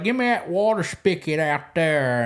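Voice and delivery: mock thick country twang; put-on backwoods country accent; cranked-up country accent. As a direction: imitate someone faking a heavy country accent. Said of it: "they would just crank that accent up".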